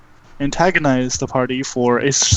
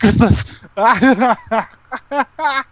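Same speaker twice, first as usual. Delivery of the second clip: sputtered, drawn-out words through coughs; coughing up smoke; 10 semitones higher, much slower than usual. Acted. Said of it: "coughs up a bunch of smoke".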